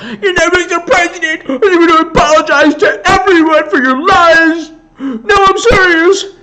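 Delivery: very high, mocking falsetto with a fake sob; whiny squeaky high voice, hammed up to the end; mock press reporter. Reporter pleading with the president in a mock high wail. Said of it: "it was like".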